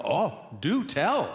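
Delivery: slow, drawn-out, high lilt; mock fascination. Comedian egging on the senator, mock fascination. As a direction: feign eager interest, stretching each word.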